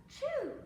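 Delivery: gasping high sneeze; big sneeze after a gasp. Acted. Duck sneezes.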